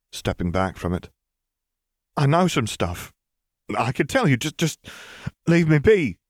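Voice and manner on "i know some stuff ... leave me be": frightened high-pitched bargaining; pleading bargain. A narrator's reading. Pansy bargains for her life.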